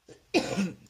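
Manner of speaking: real hacking cough; sudden dry cough; smoker's cough. Real, not acted. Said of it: "excusez-moi, j'ai une vieille toux".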